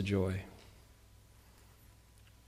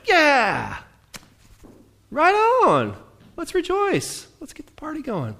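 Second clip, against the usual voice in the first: much higher, bubbly cheer; mock enthusiastic; 13 semitones higher, 3 dB louder, slower than usual. Preacher mimics his eager self, high and excited. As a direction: gush like it's a party. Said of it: "some days I'm like".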